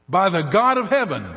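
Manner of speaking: quick start, then a loud high vow; swearing an oath. Acted. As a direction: swear it before God.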